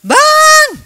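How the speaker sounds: high, loud call across the field; shouting for help. Retold or imitated. Fallen man calling a passer-by for help, loud high shout. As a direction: yell out to him from the ground. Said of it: "saya cuman teriak-teriak".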